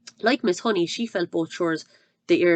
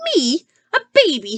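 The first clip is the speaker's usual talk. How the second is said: high, louder outraged cry; shouted; 8 semitones higher, 8 dB louder than usual. Acted. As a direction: shout it in disbelief. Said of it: "shouted the Trunchbull".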